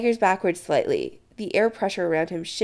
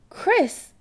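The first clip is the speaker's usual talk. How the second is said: high, lightly acted shock; aghast; 13 semitones higher than usual. Adam to Chris about his mother, mildly aghast. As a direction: say it aghast, pitch jumping up. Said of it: "Adam says aghast".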